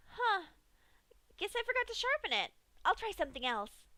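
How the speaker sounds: high, fast, offhand Pinkie voice; matter-of-fact Pinkie. A narrator's reading. Pinkie shrugging off the dull knife, high and fast. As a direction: toss it off like nothing matters. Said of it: "stated Pinkie matter-of-factly".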